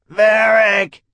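high, loud terrified scream; panicked scream. A narrator's reading. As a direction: scream his name in panic. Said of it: "he screamed, pulsing with terror and mindless panic".

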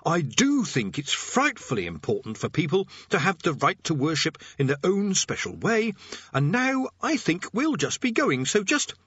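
high, anxious backing-off voice; nervous babbling. Acted. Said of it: "said Rincewind instantly".

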